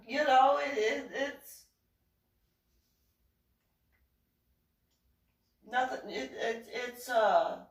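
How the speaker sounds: high, halting stammer; nervous stalling. Retold or imitated. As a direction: stumble through an acted cover-up. Stalling while hiding her arm.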